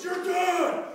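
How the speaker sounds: furious parting shout; angry threat. Acted.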